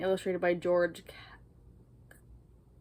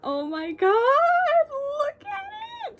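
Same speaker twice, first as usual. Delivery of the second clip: very high, slow stretched squeal; giddy high-pitched awe; excited squeal; 9 dB louder, much slower than usual. Real, not acted. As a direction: draw out look at it in awe. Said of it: "my heart is racing".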